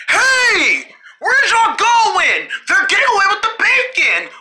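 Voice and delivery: high, drawn-out alarmed call; calling after them. Pretend. Player left behind as girls escape, high holler.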